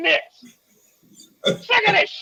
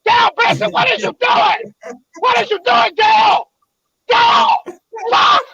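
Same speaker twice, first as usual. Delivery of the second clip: sharp angry yell, higher and louder; 4 semitones higher, 4 dB louder than usual. Real, not acted. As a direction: yell at the dog to get down. Yelling at dog mid-rant.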